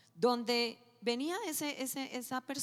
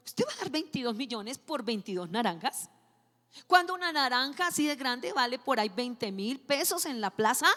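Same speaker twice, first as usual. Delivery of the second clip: indignant retort; 6 dB louder than usual. Retold or imitated. Indignant retort in retold dream.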